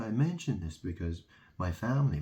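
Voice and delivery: ordinary talk, nothing marked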